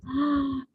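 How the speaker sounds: quick, hushed intake of breath; startled gasp. Acted. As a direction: gasp as if spotting someone. Storyteller spots someone in the dark.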